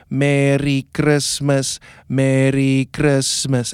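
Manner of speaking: slow rhythmic "Merry Christmas" on the beat. Shown as a demonstration. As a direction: chant each "Merry Christmas" slowly to the beat. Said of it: "with the beat".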